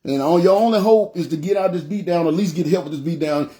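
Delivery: ordinary talk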